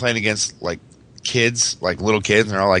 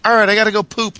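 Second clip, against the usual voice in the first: put-on high kid voice, much higher; imitating his little son; 12 semitones higher, 4 dB louder than usual. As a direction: play the little boy announcing it. Dad retells his son's line.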